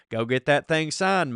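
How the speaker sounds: ordinary talk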